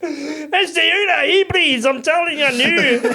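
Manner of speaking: put-on high, loud Scottish accent. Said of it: "when you do it in a Scottish accent".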